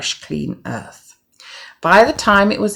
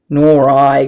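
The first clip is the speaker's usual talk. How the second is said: grunted; 8 dB louder than usual. Acted. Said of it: "grunted the fat little pig".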